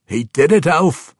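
high, very loud frustrated outburst; frustrated dwarf. A narrator's reading. Bruenor remarking to his elf friend, loudly frustrated. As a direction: shout it in exasperation as a gruff dwarf king. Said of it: "a clearly frustrated Bruenor remarked".

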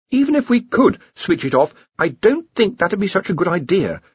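high-pitched, anxious underling; nervous Ponder. A narrator's reading. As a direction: warn the boss in a worried high voice. Ponder in story.

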